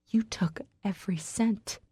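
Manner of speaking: hurt, trembling whisper. Acted. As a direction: breathe it out, barely voiced. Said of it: "I whisper".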